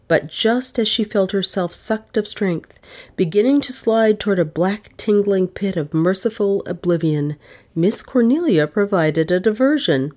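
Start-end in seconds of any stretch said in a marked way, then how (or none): none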